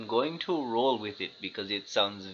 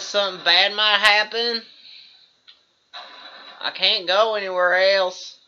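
hillbilly voice; 6 semitones higher, 9 dB louder than usual. Acted. Hillbilly character frustrated on bridge.

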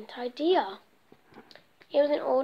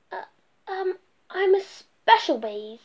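hesitant Norman; 6 semitones higher than usual. Acted. Norman fibs nervously.

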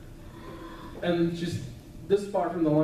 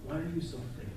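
much softer, near-whispered ask; quiet question; 10 dB quieter, faster than usual. Acted. Preacher asks the congregation in Jesus' words, softly. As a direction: ask it softly to each listener. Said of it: "Jesus is asking that of all of us today".

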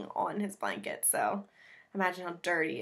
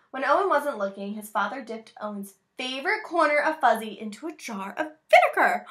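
put-on reading to children; 4 semitones higher, 8 dB louder than usual. Reads picture book aloud.

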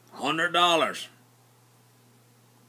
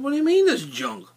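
indignant retort; 11 semitones higher, 3 dB louder than usual. Retold or imitated. He retorts to the buddy.

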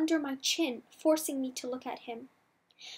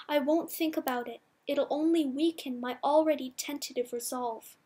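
usual speaking voice

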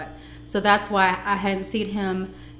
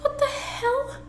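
shoots way up high in shock; stunned disbelief; slower than usual. Retold or imitated. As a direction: squeal it out like you can't believe it. Stunned by his memory.

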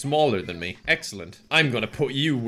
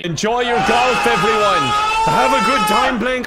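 high, very loud yell; shouted send-off; 9 dB louder than usual. Acted. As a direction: holler it out at full volume. Shouting farewell to the players.

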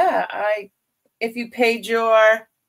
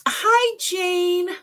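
cheery hello; 11 semitones higher, 3 dB louder than usual. Real, not acted. Greeting viewer brightly.

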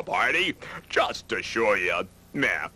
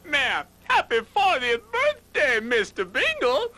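excited greeting. Acted. Gator greeting the snowman on his birthday, high excited cheer. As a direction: squeal the birthday wish up high.

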